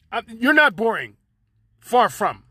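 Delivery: normal talking voice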